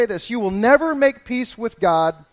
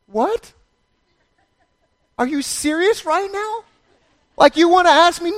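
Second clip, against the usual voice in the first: much higher, outraged disbelief; incredulous; 10 semitones higher, 5 dB louder than usual. Pretend. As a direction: sound floored by the question. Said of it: "She's like".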